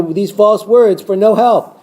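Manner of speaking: ordinary talk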